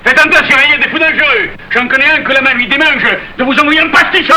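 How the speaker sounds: high, heated threatening voice; angry threat. Acted. Marius threatens Panisse with a slap, angry. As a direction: threaten him with voice pitched high.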